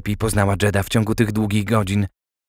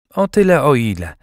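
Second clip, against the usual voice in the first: curt reply; 4 semitones higher, 5 dB louder than usual. A narrator's reading. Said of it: "odpowiedziała krótko".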